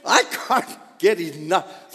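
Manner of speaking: laughing through the words; can't stop laughing. Real, not acted. Laughing while quoting.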